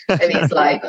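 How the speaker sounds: usual speaking voice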